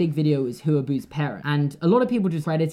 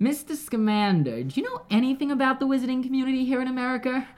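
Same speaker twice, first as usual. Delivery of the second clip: higher-pitched mock-American movie quote; British speaker's higher, put-on American; put-on American accent; 9 semitones higher than usual. Shown as a demonstration. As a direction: recite a film line in an attempted American accent. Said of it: "Can you do an American accent?".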